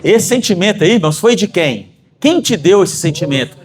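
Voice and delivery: normal talking voice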